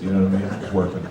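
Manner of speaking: ordinary delivery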